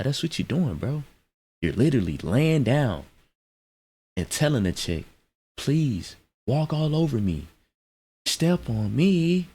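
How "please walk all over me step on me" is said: imitated begging, drawn out at the end; lightly mock a willing doormat, pitch climbing; slow, raised plea on the last words; mock pleading doormat. Mocking husband as a doormat.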